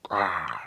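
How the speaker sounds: loud playful monster roar; Hulk roar. Pretend. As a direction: growl it like a beast. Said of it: "Anger management problems".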